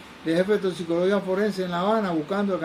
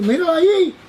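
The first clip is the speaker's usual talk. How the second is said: much higher and louder yell; shouting; 10 semitones higher, 9 dB louder than usual. Retold or imitated. Drunk man spotting the doctor, shouting to his friend. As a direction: call out across the cafeteria. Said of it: "Pero gritaba".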